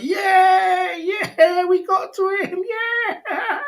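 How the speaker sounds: shrill taunting victory cheer; mock gloating shout. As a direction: yell it like gloating trolls. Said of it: "They're like".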